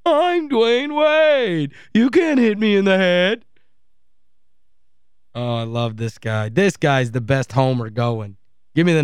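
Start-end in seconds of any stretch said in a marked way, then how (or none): 0.1-3.4 slow, high mock whine on "I'm"; mimicking Dwayne Wade. Host quotes Wade from the clip, slow high mockery.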